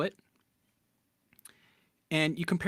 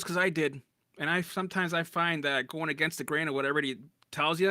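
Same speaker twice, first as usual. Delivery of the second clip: normal talking voice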